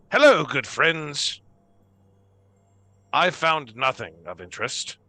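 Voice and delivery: a hint of a stilted, hammed-up hello; possessed mechanical man. Possessed Leonard greeting the others, mildly hammed-up. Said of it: "and you say".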